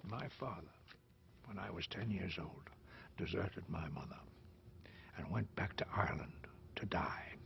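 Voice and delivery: low, hushed, bitter recollection; soft, resentful confession; quiet bitterness. Acted. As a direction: drop nearly to a whisper, with pauses. Bitter memory of deserting father.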